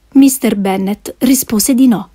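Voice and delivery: ordinary delivery, nothing marked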